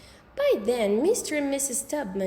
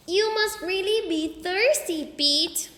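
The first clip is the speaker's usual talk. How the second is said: put-on little kid voice; 8 semitones higher, 4 dB louder, slower than usual. A narrator's reading. Fudge teasing.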